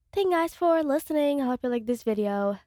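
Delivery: plain reading voice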